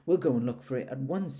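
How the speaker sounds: put-on boy calling out, ready to go. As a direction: call across to a friend. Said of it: "he called out to Eeyore".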